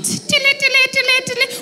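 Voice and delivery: very high tiny clicking noises; mock heel clicks. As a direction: imitate high heels tapping. Teacher's heels clicking sound.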